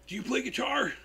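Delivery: high, friendly guitarist voice. Retold or imitated. Telling fans how [name] greeted a kid, high friendly voice. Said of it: "you know how [name] was".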